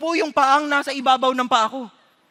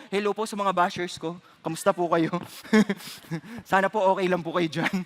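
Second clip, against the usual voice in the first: teasing greeting; 5 semitones lower than usual. Real, not acted. Preacher greeting his online bashers, teasingly sweet. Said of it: "greeting bashers teasingly".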